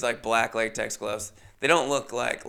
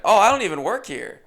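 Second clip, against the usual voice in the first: louder, higher cheeky quip; deadpan joke; 7 semitones higher, 6 dB louder than usual. Retold or imitated. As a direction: toss off a cheap joke. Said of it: "and I just went".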